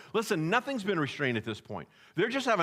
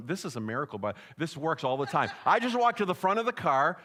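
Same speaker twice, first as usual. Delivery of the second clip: usual speaking voice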